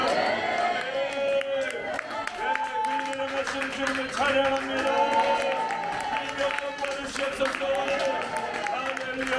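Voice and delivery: loud, high shouted praise; fervent closing praise. Real, not acted.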